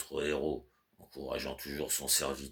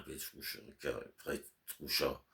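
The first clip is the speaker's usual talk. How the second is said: breathed softly, barely audible; whisper; 9 dB quieter than usual. Acted. Master whispers the servant's name to calm him, unvoiced.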